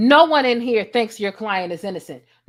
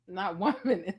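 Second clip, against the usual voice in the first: laughing; 3 semitones lower, 7 dB quieter than usual. Real, not acted. Repeating quote through laughter.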